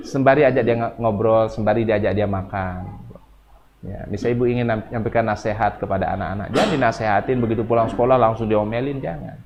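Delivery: ordinary talk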